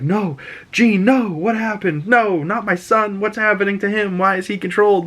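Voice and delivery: high, distraught cries; panicked Scott. Retold or imitated. Scott watching from space in panic, high and distraught. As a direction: plead in disbelief.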